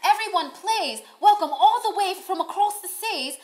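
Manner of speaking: loud, high, hammed-up British welcome; mock British accent. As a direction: announce her grandly in a fake British accent. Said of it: "am I doing a good accent?".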